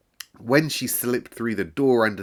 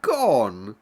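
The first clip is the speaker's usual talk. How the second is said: woefully; 12 semitones higher, 5 dB louder than usual. A narrator's reading. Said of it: "she said woefully".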